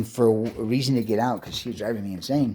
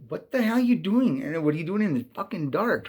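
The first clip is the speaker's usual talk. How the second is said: higher, louder mock yell that settles down; imitating girlfriend yelling; 7 semitones higher, 3 dB louder than usual. Girlfriend yelling at him in the dark, higher mock yell. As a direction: snap it out like a furious girlfriend.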